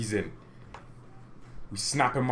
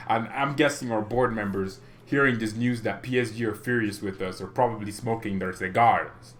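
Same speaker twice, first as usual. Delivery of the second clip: usual speaking voice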